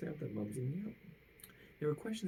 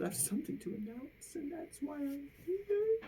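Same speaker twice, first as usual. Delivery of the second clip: tearful announcement; 13 semitones higher than usual. Acted. Sad principal announces bad news, tearfully. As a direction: sound close to tears. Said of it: "He said".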